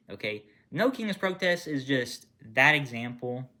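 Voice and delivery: normal talking voice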